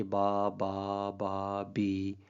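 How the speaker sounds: soft "बा, बा, बा" then a final "बी" with a different vowel; changed vowel. Shown as a demonstration. Teacher reads nonsense syllables to show that vowel quality makes stress. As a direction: let the last syllable switch to "बी". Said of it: "अब ये last जो आपको सुनाई दिया".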